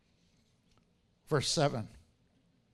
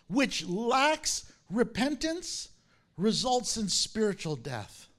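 raised, measured Bible reading voice; preacher proclaiming scripture, slow in places; 6 semitones higher, 5 dB louder than usual. Acted. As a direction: read the verse out with weight to the congregation. Reads scripture aloud.

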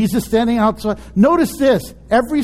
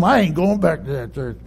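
grumbling; 7 semitones lower, 3 dB quieter than usual. Retold or imitated. Grumbling while driving home.

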